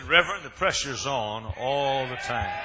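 loud, slow, strained complaint; wealthy church member shouting at the reverend, slow and loud; imitating a shouting rich man. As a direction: shout it at the preacher like he's fed up. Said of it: "He said".

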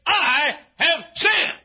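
slow, drawn-out cry, high and loud; loud confession. Acted. Dad at the mirror confessing, slow and loud. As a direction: cry out the confession slowly, every word weighed. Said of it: "he says".

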